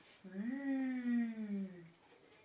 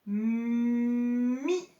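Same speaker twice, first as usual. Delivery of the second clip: mi stretched, loud; sounded-out mi; 9 dB louder than usual. Shown as a demonstration. Teacher sounding out the second syllable for the class. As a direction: draw out mi.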